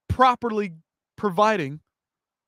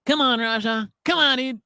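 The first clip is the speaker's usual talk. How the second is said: nasal-less mock whine, a bit higher; annoying whiny voice; 5 semitones higher than usual. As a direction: imitate an annoying cameraman. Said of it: "most annoying fucking prick voice".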